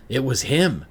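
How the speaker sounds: blurting it out in shock; a jolt of fear, voice jumping up; terrified realization. Acted. Terror in the mirror.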